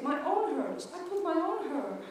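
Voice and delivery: normal talking voice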